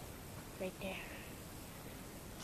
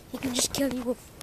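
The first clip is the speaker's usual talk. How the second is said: ordinary delivery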